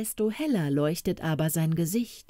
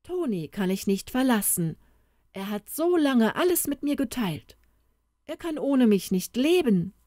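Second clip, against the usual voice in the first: put-on higher, earnest little boy's voice; earnest boy Beppo; 7 semitones higher than usual. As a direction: plead for his friend as a small boy.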